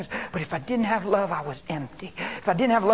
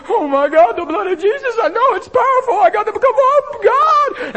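loud high-pitched frantic cry; mock panicked plea; 12 dB louder than usual. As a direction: wail to God in hammed-up desperation. Acts out a panicked believer's plea.

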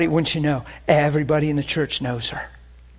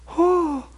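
imitating her sudden high "oh"; high-pitched "oh". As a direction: let out a thin, high mock cry. Retold woman's wordless cry when healed.

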